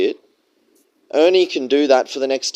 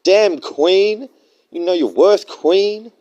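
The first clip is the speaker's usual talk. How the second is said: higher, hammed-up fanboy cheer; mock gushing simp; 8 semitones higher, 4 dB louder, slower than usual. Mocking a simp cheering for the woman. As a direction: gush over her like a fawning fan.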